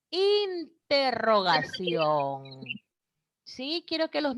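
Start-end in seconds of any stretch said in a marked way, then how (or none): 0.1-2.6 very slow, syllable by syllable; teacherly, slowly spelled-out "interrogación"; drawn-out key term. Real, not acted. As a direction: stretch out "interrogación" slowly for the class.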